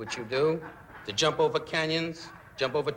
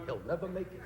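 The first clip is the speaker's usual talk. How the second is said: much softer, muttered aside; promoter aside; 10 dB quieter than usual. Retold or imitated. Promoter betting against the stuntman, muttered softly.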